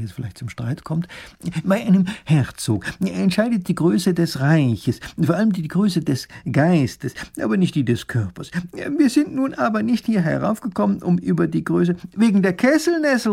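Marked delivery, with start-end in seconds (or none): 1.6-12.0 high, loud scholarly voice; placating professor. Acted. 12.2-13.3 high, loud little duke; shrieked correction from a small noble; interrupting cry. A narrator's reading. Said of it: "ruft der kleine Großherzog".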